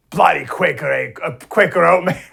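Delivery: playful fake British; mock British accent. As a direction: keep the bad British voice going. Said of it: "I'll keep the British accent to Jesse".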